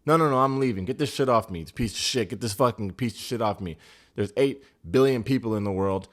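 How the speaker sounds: loud, worked-up acted tantrum; mock meltdown. Recapper acts out contestant quitting the show, mock meltdown. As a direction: act out storming off in a huff. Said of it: "Now Sophie's about to crash out".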